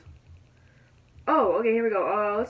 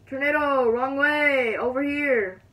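raised, louder call into the distance; 3 semitones higher, 4 dB louder, slower than usual. Pretend. Gamer calling the tornado back, loud call.

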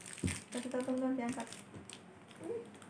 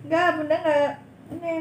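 ordinary delivery

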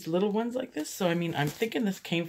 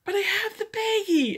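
high, louder sheepish plea; pleading husband; 3 dB louder than usual. Retold or imitated. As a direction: hold up the bag hopefully. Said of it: "He's like".

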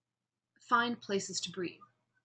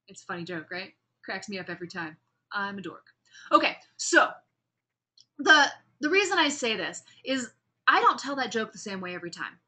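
usual speaking voice

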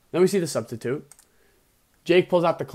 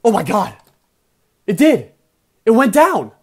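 high, loud shouts of amazement; excited disbelief, shouted; amazed shout; 7 semitones higher, 10 dB louder than usual. Real, not acted. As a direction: yell it in short bursts, astonished. Pokemon finally faints after long stall.

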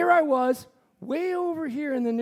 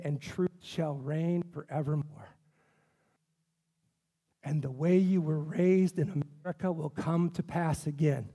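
voice of God; 7 semitones lower, 5 dB quieter than usual. Retold or imitated. God gently promises justice to the pastor, low and soft. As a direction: hush down low and let God comfort him. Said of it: "and you know what God said?".

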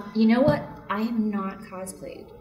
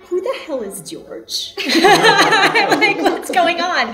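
laughing disbelief; 11 semitones higher, 10 dB louder, much slower than usual. Retold or imitated. Retold confusion, laughing.

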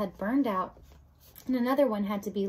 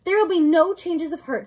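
loud, raised-pitch decree; firm refusal; 6 semitones higher, 9 dB louder than usual. Acted. Rat denies the girls any mercy, loud firm refusal. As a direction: shut down all hope forcefully.

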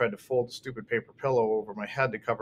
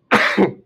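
loud cough; 9 semitones higher, 13 dB louder than usual. A narrator's reading. Cough in scene.

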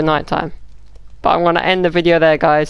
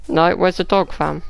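usual speaking voice

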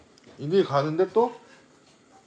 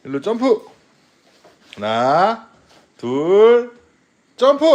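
louder, rising count to the jump; excited countdown; 6 semitones higher, 6 dB louder, much slower than usual. Real, not acted.

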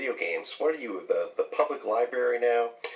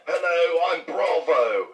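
higher fake British greeting; mock British accent; 5 semitones higher, 5 dB louder, slower than usual. Playing the British neighbor saying hello, mock British accent. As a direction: put on a cartoon British accent. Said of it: "I think I have your accent down perfectly".

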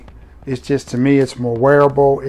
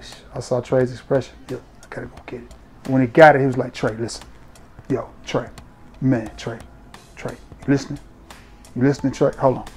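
hushed, softer friend's voice begging Trey to listen; Sam Broom impression; 8 dB quieter than usual. Friend Sam raving to Trey about the fragrance, hushed impression. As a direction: quietly play the hyped buddy. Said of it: "my little Sam Broom impersonation".

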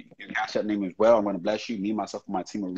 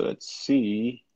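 ordinary talk

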